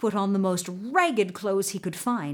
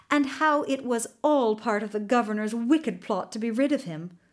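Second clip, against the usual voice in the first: usual speaking voice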